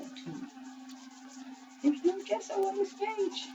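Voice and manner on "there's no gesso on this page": high, slow sing-song voice; silly high voice. Pretend. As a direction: repeat it in a squeaky silly voice. Repeats line in silly voice.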